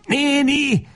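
very high, desperate call; frantic cry. A narrator's reading. Lajos beside himself at the closed door, frantic cry. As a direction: cry out beside yourself, pitch soaring. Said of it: "kiáltja magán kívül".

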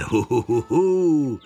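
ho-ho-ho rising into a long high hoot; loud ho-ho laugh. Acted. Big fisherman gloating over his plans, loud ho-hoing. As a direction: hoot a big mock laugh, last note held high. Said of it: "Zenet hangosan hohózott".

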